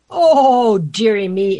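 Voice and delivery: loud theatrical "oh" sinking into self-pity; hammed-up sorrowful wail; mock sobbing. A narrator's reading. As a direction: lament like a pampered storybook king. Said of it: "The King sobbed".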